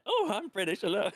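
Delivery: high, mocking fake British; deliberately awful British accent; worst British accent. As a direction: play an American faking British. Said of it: "the worst accents".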